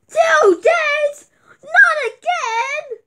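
high whining complaint; whiny cry. Acted. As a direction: whine it like a let-down kid.

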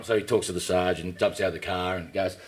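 ordinary delivery

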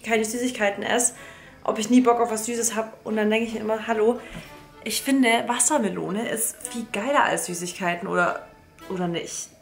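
normal talking voice

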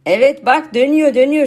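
usual speaking voice

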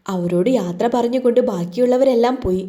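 plain reading voice

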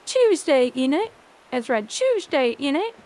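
British "Tuesday" with a "choose" start, then "innit"; British pronunciation. Shown as a demonstration. Streamer mimics British talk, "Tuesday" said as "Chewsday". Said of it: "It's not Tuesday, isn't it?".

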